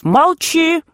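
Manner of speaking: high-pitched yelp of command; squealed order. A narrator's reading. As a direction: squeal it, voice leaping up. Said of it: "взвизгнув". (визгливый окрик)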